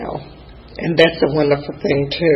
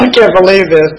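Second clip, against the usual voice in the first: laughing through tears; 2 semitones higher, 5 dB louder than usual. Real, not acted.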